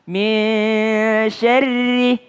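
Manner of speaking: SYARRI with a light, thin R and long-held MIN. Shown as a demonstration. Teacher correcting students' syarri, thin R. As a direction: keep the RI of SYARRI thin, not rounded.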